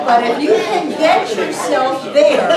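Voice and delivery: loud laughter carrying into the words; high, loud laughing delivery. Real, not acted. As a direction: laugh it out, then push on. Laughing outburst mid-teaching.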